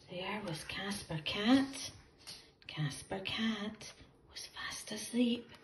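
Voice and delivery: soft whisper so as not to wake him; hushed whisper. A narrator's reading. Narrator hushing the children near the sleeping cat, whispering.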